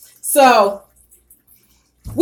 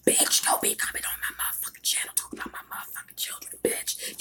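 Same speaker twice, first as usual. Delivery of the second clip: fast, breathy whispered tirade; ASMR whisper scolding a troll; whispered cussing; 15 dB quieter than usual. Shown as a demonstration. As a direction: cuss them out in a hush. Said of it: "let me give an example".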